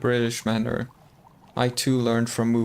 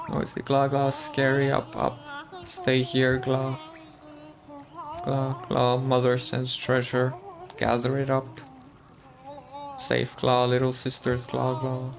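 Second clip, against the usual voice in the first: lightly voiced, even-toned worm babble; babbling worm creature. Streamer reading out a game worm's lines, lightly voiced creature. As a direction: voice a simple creature, steady and monotone.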